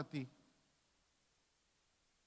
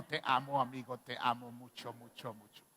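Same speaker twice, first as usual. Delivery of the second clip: imitating Cletus; 6 semitones lower than usual. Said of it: "hizo una imitación".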